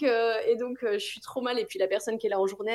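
ordinary talk, nothing marked

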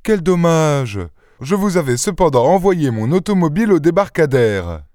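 high, effusive regret; exuberant. A narrator's reading. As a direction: gush like an overeager host. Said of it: "s'écria plein d'exubérance".

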